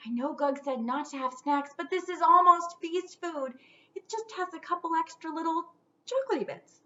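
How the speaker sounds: high, sheepish little ghost. Acted. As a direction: talk yourself into the treat.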